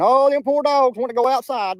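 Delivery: high, loud, drawn-out mock whine; mock crying complaint. Mocking someone upset about barking dogs, high mock-crying whine. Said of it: "A D's gonna be over there crying".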